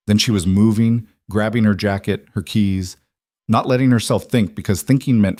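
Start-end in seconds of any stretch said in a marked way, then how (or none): none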